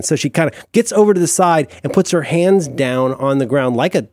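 ordinary delivery

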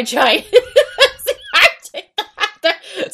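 losing it with real laughter mid-read; cracking up. Real, not acted. Reader botching the line about pajamas, real cracking up. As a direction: try to read on through high-pitched laughter.

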